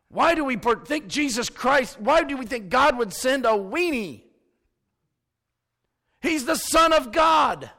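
the pastor preaches Jesus was no weenie, full force; full-force preaching. Acted. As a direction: hammer each point home to the congregation.

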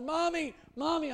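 high calling out to mom; calling for mommy. Retold or imitated. Boy calling his floating mother, high calling out. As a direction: call out across the water. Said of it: "he kept calling her".

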